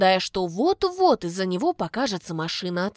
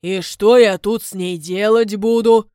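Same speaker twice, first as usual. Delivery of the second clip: doubtful man; 9 dB louder than usual. A narrator's reading. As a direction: ask it with doubt. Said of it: "спрашивает тот с сомнением".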